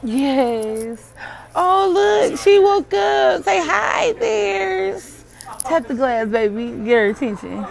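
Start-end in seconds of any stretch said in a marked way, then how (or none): 1.6-5.2 excited cooing at puppy. Real, not acted. Woman greeting a just-woken puppy behind glass, higher excited coo.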